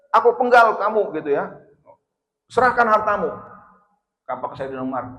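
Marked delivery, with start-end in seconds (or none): 0.1-1.1 mock threat. Robbers threaten Umar. 2.5-3.3 mock demand. Robbers demand his wealth.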